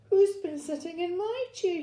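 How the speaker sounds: put-on high little Baby Bear; tiny wee voice. A narrator's reading. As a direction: ask it like a small, upset cub. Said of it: "he said in his tiny wee voice".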